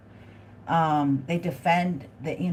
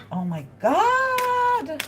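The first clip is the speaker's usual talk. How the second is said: drawn-out, much higher squeal of delight; thrilled squeal; 4 dB louder, much slower than usual. Real, not acted. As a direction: let the oh my God stretch out high and slow. Reacting to the cards.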